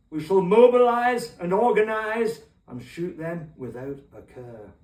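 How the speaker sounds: wartime orator, measured and deliberate; put-on statesman's oratory; Churchill impression. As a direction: do a rousing wartime leader's speech, dropping away at the end. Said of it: "my best Churchillian accent on this one".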